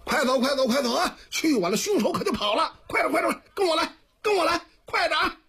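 high, rapid urging of a crowd onward; storyteller's high-pitched hurry-up call; urgent calling out. A narrator's reading. Someone over there hurrying men after fleeing attackers, calling out urgently. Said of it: "就听见那边有人喊上了".

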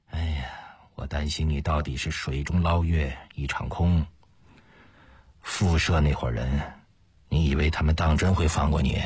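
low, doubting voice of a worried friend; worried companion. Acted. Ma fears his partner's plan will come to nothing, worried.

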